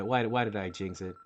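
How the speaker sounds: ordinary talk, nothing marked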